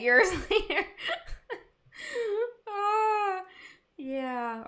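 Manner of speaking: real helpless laughter breaking into the words; high, teary laugh ending in a sigh; laughing to tears. Real, not acted. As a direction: crack up till you tear up, voice shooting high. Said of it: "I'm literally tearing up".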